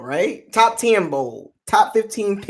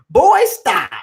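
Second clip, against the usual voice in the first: a lightly put-on big boy voice, a bit louder; 9 semitones higher, 5 dB louder than usual. To the chat room, a lightly voiced big boy voice. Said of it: "In my big boy voice".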